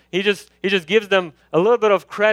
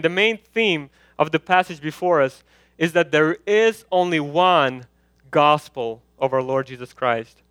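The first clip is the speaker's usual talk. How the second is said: normal talking voice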